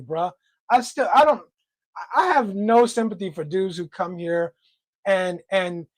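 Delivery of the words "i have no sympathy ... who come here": usual speaking voice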